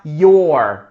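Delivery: "your" said as one short syllable "yor"; contracted demo. Shown as a demonstration. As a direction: merge "you are" into "your". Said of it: "vão juntar os dois e vai virar".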